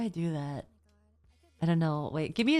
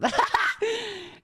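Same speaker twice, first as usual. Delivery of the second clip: very high real laugh; burst of laughter; 14 semitones higher than usual. Real, not acted. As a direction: crack up at a high pitch. Laughing at chat confession.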